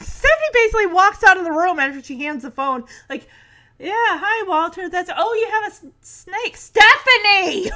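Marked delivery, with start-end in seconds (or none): 3.8-6.6 higher, laughing phone politeness; awkward polite chatter; strained fake cheer. Jesse stuck on the phone with Walter, forced cheer. 6.6-7.7 loud, drawn-out call for help. Retold or imitated. Jesse stuck on the phone calls Stephanie back, loud.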